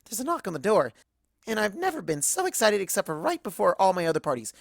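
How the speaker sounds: plain reading voice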